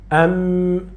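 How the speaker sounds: usual speaking voice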